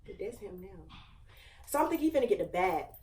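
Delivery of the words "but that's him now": hushed, much softer aside; whispered aside. Real, not acted. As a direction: drop to a hush as if he might hear. Aside, he is calling now.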